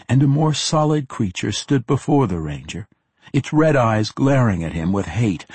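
usual speaking voice

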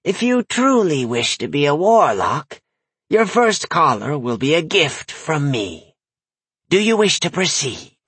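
lightly voiced leering crone; a hint of a sly old witch. The old dwarf female leered.

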